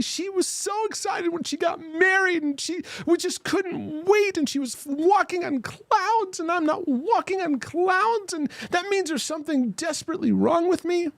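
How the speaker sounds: high frantic parody of a woman fretting about love; over-the-top mock lament. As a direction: ham up her despair in a high, rushed wail. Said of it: "I'm adding drama to it".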